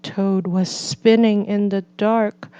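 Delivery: plain reading voice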